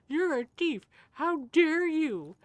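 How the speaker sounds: mock a self-righteous crook, hammed up; mock indignant Credence. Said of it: "He's like".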